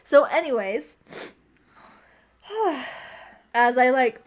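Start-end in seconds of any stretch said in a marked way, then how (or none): 2.5-3.2 long exhaled sigh; composing sigh. Real, not acted. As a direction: sigh to pull yourself back together. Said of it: "put myself back together".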